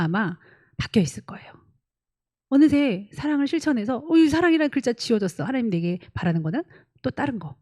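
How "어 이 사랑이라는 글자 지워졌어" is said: surprised realization. Acted. Believer discovering change.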